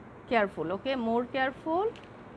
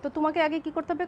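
ordinary talk, nothing marked

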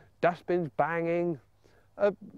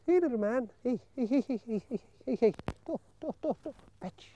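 calling the puppy; 8 semitones higher, 4 dB quieter than usual. Shown as a demonstration. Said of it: "What we'll do".

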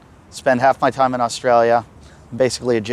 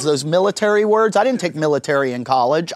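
usual speaking voice

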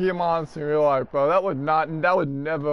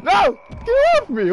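very high shout breaking up; voice cracking; 5 dB louder than usual. Real, not acted. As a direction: yell until the voice cracks. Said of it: "Oh, I had my voice cracked there".

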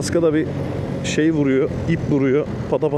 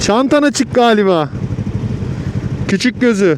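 calling out louder; 10 semitones higher, 8 dB louder than usual. Real, not acted. Repeats after rider asks Efendim. (yavaşça bağırarak tekrar)

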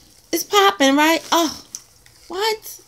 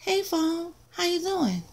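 put-on higher, softer playful girlfriend voice; mermaid voice; 6 semitones higher, 6 dB quieter than usual. Mermaid sticker greeting the phone, playful high voice.

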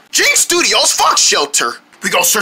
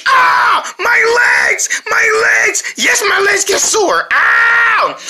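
mock pained cry; 11 semitones higher, much slower than usual. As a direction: whine it like your legs ache in a skit. Legs ache in ad skit.

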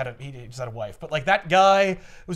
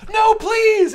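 much higher, louder begging; desperate plea; 8 dB louder than usual. Retold or imitated. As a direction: beg for mercy, hammed up. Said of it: "And Marcia goes".

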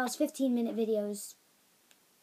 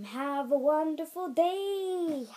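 drawn-out, higher sign-off; old sign-off; 4 semitones higher, much slower than usual. Retold or imitated. Vlogger signing off to viewers, drawn-out sign-off. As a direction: sing out the farewell, very slowly. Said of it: "at the end, I had, like".

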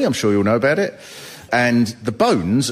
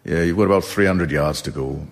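unflappable calm; 5 semitones lower than usual. Retold or imitated. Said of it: "they're just calm".

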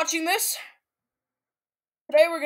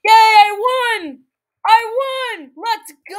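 high, drawn-out victory yell; excited cheer; 8 semitones higher, 9 dB louder, slower than usual. Real, not acted. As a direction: whoop it up after winning. Player cheers a win.

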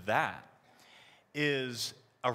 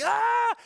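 high, loud imitated child's scream; 8 dB louder than usual. Retelling son with toothpaste, high loud mock scream. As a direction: let out the kid's scream again. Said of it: "he's screaming".

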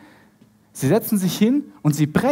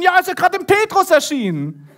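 high-pitched acted burst of news; excited reply; 10 semitones higher than usual. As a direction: blurt it out over the commotion. Acted excited shouted exchange of news.

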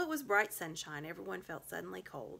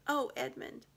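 tearful; 9 semitones higher, 4 dB louder than usual. A narrator's reading. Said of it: "and began to cry".

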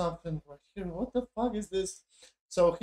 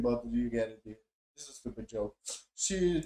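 normal talking voice

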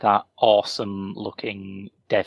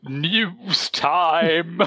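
announcer call; 7 semitones higher than usual. Real, not acted. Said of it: "segment jingle call".

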